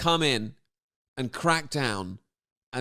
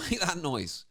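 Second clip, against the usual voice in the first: ordinary talk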